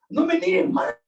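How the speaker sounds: ordinary delivery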